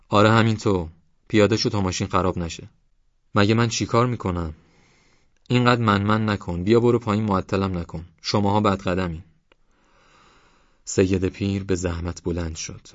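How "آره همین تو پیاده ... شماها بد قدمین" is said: angry driver. A narrator's reading. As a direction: berate him to get off the bus. Driver scolds old man. (سرزنش عصبانی و بلند)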